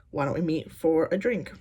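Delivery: normal talking voice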